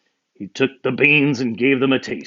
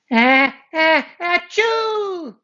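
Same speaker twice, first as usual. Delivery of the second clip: high, building fake sneeze; 5 dB louder, much slower than usual. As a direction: wind up and let the sneeze fly. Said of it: "sneezed Avery".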